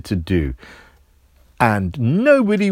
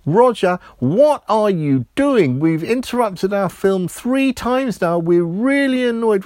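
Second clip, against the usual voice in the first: higher, louder exasperated scolding; annoyed parents; 9 semitones higher, 4 dB louder than usual. A narrator's reading. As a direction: tell him off, fed up.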